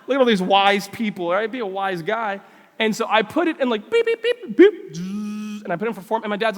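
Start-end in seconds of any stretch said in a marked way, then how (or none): 3.9-5.6 imitated microwave beeps. Son setting the microwave, imitated beeps and buzz. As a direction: mimic the button beeps and hum.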